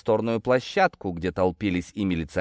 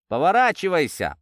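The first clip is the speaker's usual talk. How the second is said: snapped order, higher and a bit louder; angry command; 7 semitones higher, 4 dB louder than usual. Acted. Sergeant ordering the detainee, angry command. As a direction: bark it at the prisoner. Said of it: "зло скомандовал сержант".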